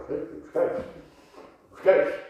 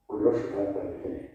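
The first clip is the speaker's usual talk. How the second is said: normal talking voice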